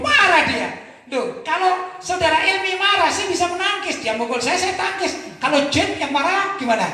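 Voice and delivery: normal talking voice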